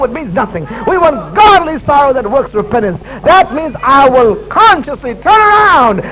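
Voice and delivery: loud, fervent sermon delivery; preaching at full force. Acted. Pastor preaching hard to his church, fervent and loud. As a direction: drive it home to the congregation.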